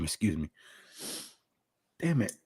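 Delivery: wincing through heartburn; pained by heartburn. Real, not acted.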